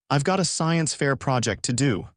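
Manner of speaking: plain reading voice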